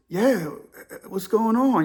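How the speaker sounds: mildly groggy phone voice; imitated sleepy answer; groggy, waking up. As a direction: still half asleep, trying to catch up. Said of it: "grog is still waking up".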